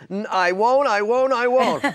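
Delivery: a childhood Munster impression; Herman Munster. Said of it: "was like Herman Munster".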